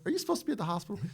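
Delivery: high disbelieving question; incredulous. Retold or imitated. Colonel repeats the question to the Marine, high and disbelieving. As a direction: let the disbelief ring out. The same question quoted again.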